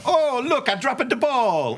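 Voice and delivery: high, hammy 1930s ballplayer voice; old-timey ballplayer. Retold or imitated. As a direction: play it like an innocent 1930s ballplayer. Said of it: "I don't know how they talked in nineteen thirties baseball".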